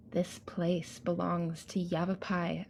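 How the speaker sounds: ordinary talk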